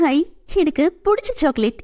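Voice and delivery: put-on light up like a kid seeing sweets; delighted. A narrator's reading. Girl spotting her favourite chocolate, delighted. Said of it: "என்று குதித்தவள்".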